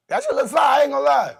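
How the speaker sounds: loud, high-pitched excited yelling. Real, not acted. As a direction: shout it at the audience. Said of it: "stop yelling at the audience".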